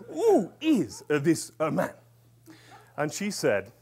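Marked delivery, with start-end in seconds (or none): 0.1-1.9 put-on high, loud, halting French accent; overdone French imitation. Facilitator asking who the man is, comic French accent. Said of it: "'Cause he's French".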